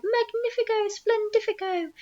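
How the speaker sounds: high-pitched jubilant outburst; delighted cry. A narrator's reading. Aunt celebrating with her sister, high gleeful cry. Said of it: "Aunt Sponge cried out".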